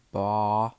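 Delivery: drawn-out, level baa; lamb's bleat. A narrator's reading. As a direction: imitate a bleating lamb. Imitated frightened lamb bleat.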